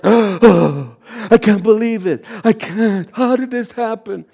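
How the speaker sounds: gasping, slow mock disbelief; hammed-up shock after a big gasp; mock freaking out. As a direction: imitate stunned news people aghast. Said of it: "they're like this, ... freaking out".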